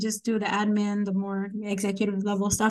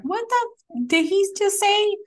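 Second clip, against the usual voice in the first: high-pitched, indignant outburst; furious disbelief; 11 semitones higher, 4 dB louder than usual. Retold or imitated. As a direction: retell it with shrill-pitched outrage. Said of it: "I was just furious".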